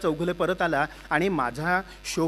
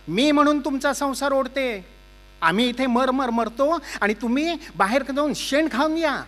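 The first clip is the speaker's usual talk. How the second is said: loud, higher nagging rant; scolding wife; 6 semitones higher, 4 dB louder than usual. Acted. Wife scolding Chougule over the spoiled dessert, loud nagging rant. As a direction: tear into the husband.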